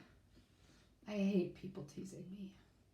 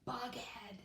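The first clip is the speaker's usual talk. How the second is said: whisper; 3 semitones higher, 9 dB quieter than usual. Acted. Mocking nickname said secretly.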